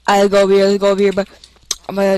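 usual speaking voice

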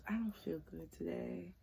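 soft, feeble fake-sick voice; faking frailty. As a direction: play it weak and sorry. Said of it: "I've done that plenty of times".

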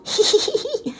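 squeaky high giggle. Acted. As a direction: giggle like you can't help it. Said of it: "He giggled".

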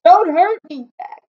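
high, pleading cry; yell. A narrator's reading. Dreaming giant begging his attacker, yelling. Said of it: "he yelled".

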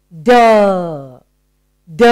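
"D" as a punched "duh"; letter sound. Shown as a demonstration. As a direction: sound out "D" as in dog, not the letter name. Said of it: "What is the sound?".

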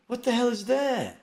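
slow, baffled high disbelief; incredulous. Real, not acted. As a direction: drag out the confusion. Mocking reaction to "kikiing.

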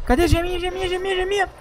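high, soft sing-song; squeaky searching. Real, not acted. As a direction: coo it up high. Searching for gem.

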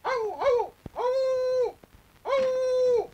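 high-pitched dog barks and howls; drawn-out high "hav" barking; dog bark imitation. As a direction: bark like a dog to signal someone. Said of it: "köpek gibi havlamıştım".

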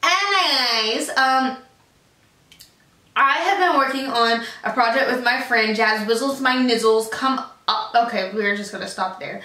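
ordinary talk, nothing marked

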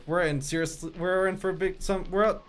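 very soft muttering; soft worried mutter; muttered dread. Real, not acted. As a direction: mumble it to yourself in dread.

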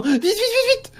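high squeaky panic; panicked squeal. Real, not acted. Panicked hurry at Momo.

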